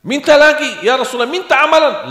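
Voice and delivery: high, loud pleading voice; pleading companion. Acted. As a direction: beg the Prophet eagerly. Sahabat meminta ketiga kali. (sahabat memohon dengan suara tinggi dan keras)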